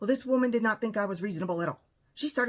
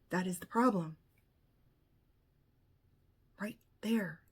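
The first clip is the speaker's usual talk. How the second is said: ordinary delivery, nothing marked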